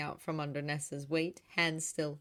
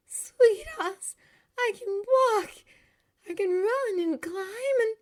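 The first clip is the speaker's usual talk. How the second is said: very high, loud, drawn-out joy; ecstatic; 8 dB louder, slower than usual. A narrator's reading. Girl realises she can run and climb, ecstatic. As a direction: exclaim it, bursting with excitement. Healed girl rejoicing at walking.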